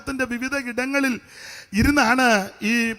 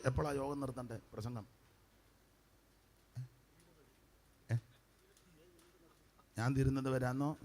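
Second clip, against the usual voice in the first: very quiet, low private check on the time; low murmured aside to a colleague; hushed aside; 10 semitones lower, 18 dB quieter, faster than usual. Real, not acted. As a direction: drop right down for an off-sermon word. Private aside asking about finishing time.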